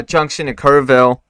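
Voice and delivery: ordinary talk, nothing marked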